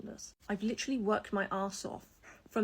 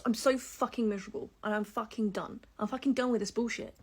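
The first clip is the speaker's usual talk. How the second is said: normal talking voice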